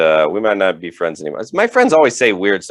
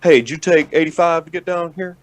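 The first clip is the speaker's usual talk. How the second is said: dad voice; 8 semitones higher than usual. Retold or imitated. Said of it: "he's like".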